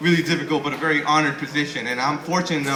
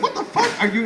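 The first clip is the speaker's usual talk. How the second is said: very high mock woman's shriek; high girly shocked voice. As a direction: play the startled girl, way up high. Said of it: "she's like".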